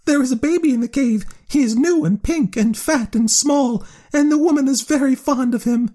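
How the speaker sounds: high piping bat chatter. A narrator's reading. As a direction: pipe it up high like a tiny bat. Bat character voice.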